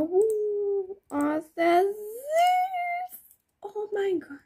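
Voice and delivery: high, slow cooing delight. Real, not acted. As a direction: coo over something cute. Cooing over cute horse.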